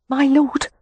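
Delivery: high, hesitant maid's voice; a frightened housemaid finds her nerve; faltering maid. A narrator's reading. A housemaid faltering as she addresses the lord. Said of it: "the maid faltered".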